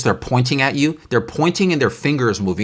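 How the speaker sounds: ordinary delivery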